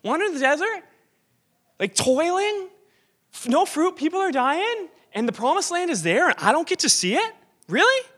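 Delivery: high, loud indignant complaint; loud, high-pitched mock outrage; exasperated Moses. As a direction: rail at God in disbelief. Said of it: "throw his hands up at God and be like".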